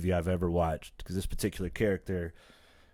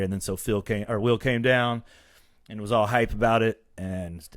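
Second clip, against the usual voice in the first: ordinary delivery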